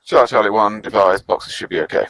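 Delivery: radio voice. Real, not acted. Radio call in milsim game.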